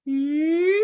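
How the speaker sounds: very high, loud sustained ee. Shown as a demonstration. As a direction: hold one long high ee. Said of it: "It goes".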